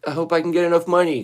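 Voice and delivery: high-pitched charity plea; mock begging. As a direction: plead for money in a mock needy voice. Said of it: "turn yourself into a charity and be like".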